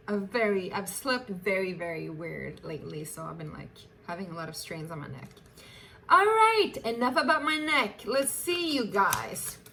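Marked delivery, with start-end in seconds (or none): 6.1-9.6 high, loud upbeat restart; bright burst of energy; brisk reset. Real, not acted. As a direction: shake it off and move on. Snapping back from neck talk.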